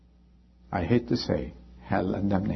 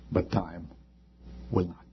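whispered, much softer invitation; hushed whisper; 6 semitones lower, 8 dB quieter than usual. Real, not acted. Preacher inviting the congregation to bow for prayer, whispered. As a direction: drop to a whisper to begin prayer.

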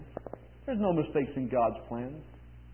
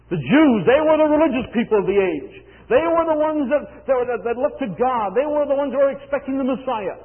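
raised, emphatic pulpit voice; impassioned preaching; 7 semitones higher, 9 dB louder than usual. Real, not acted. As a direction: drive each point home to the congregation. Preaches at full force.

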